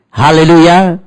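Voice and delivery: loud, high hallelujah shout; hallelujah call. Acted. Pastor calls hallelujah to the congregation. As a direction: call it out to the church.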